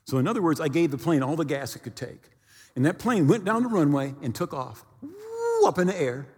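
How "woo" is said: a high swooping woo; rising woo. Retold or imitated. Preacher imitating the plane taking off, swooping high. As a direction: sweep the pitch way up like the plane climbing.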